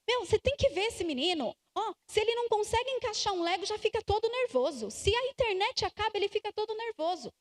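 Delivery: put-on mild exasperated complaint about the boy; complaining parent. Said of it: "Então eu digo assim".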